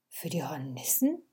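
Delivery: soft, low, puzzled question; confused. A narrator's reading. Viktoria puzzled by her mother's warning, confused. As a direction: ask it quietly, unsure, rising at the end. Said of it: "fragte Viktoria verwirrt".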